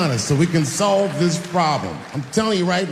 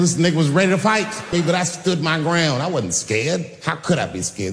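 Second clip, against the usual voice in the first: normal talking voice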